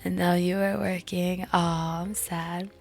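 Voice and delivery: usual speaking voice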